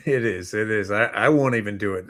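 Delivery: ordinary talk, nothing marked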